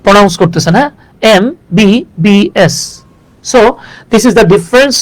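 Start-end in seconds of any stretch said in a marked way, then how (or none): 1.3-2.8 এমবিবিএস drawn out very slowly letter by letter; spelled letter by letter. Shown as a demonstration. Teacher showing how to say MBBS. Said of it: "just letter by letter".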